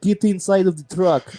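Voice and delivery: put-on Russian guard's order; mock Russian accent. Russian player ordering prisoners, mock Russian accent. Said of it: "these Russian players were like".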